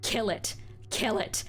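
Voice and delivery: building frantic demand to kill it; desperate screaming. Acted.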